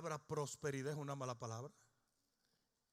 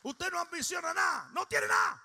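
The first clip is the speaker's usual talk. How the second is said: much higher, shouted sermon line; full-force preaching; 11 semitones higher, 10 dB louder than usual. Acted. Pastor driving home his point to the congregation, shouting.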